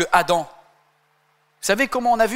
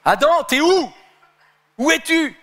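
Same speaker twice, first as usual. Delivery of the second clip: God calls out, voice raised high and louder; calling out; 10 semitones higher, 5 dB louder than usual. Retold or imitated. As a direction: call Adam out from the garden. (Dieu appelle Adam, voix haute et forte)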